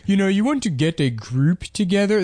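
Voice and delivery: mock a survival tutorial in a weird accent; That Sodding Gamer. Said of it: "he's like".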